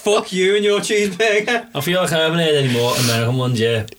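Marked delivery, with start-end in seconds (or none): 0.0-1.7 hammed-up dismissive dispatcher, higher; mock rude operator. Friend hamming up the operator telling the caller off. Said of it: "notice the difference in the tone of voice".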